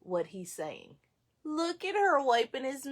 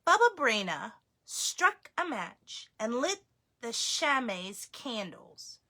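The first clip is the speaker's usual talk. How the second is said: ordinary delivery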